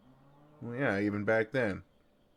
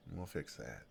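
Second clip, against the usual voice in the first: much softer and lower, intimate; tender hush; 6 semitones lower, 12 dB quieter than usual. Acted. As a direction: say it close and gentle. Warming her cold hands tenderly.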